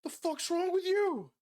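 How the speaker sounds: punched guard yelling. Acted. Struck guard to the attacker, high outraged yell. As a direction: reel from the punch and shout.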